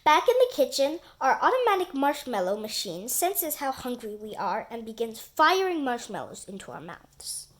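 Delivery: plain reading voice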